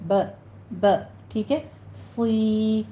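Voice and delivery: ب said alone, then صی slowly with a whistle; separate ba. Shown as a demonstration. Teacher drilling the parts of بصی. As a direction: split ب from صی. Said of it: "با الگ پڑھنا ہے".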